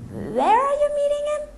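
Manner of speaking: very high, slow anxious squeak; nervous snake. Acted. The snake asking nervously where they meet. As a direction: ask in rising panic.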